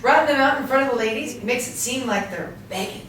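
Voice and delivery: ordinary talk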